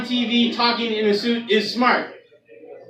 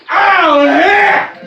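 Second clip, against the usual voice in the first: high, loud furious outburst; hammed-up rage at the TV; mock furious yell; 7 semitones higher, 7 dB louder than usual. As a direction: scream it as his wrestler flies out. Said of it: "and he goes".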